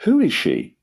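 high, exasperated question; insistent demand. A narrator's reading. Father repeating his question to an evasive son, demanding. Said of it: "demanded Lord Emsworth for the fifth time".

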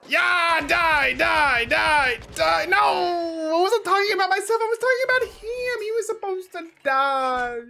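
high, loud shouts of die; excited yelling. Real, not acted. Streamer yelling at the enemy player, high and loud. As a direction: yell at the enemy to go down.

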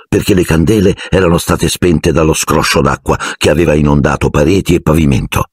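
ordinary talk, nothing marked

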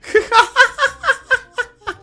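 high-pitched burst of laughter; high cackle. Real, not acted. Streamer laughing at the chat, high cackle.

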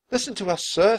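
put-on high, insistent girl; high-pitched girl urging a grown-up; Annie's voice. A narrator's reading. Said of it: "said Annie".

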